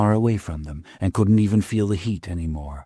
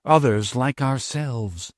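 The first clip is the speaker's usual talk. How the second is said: ordinary delivery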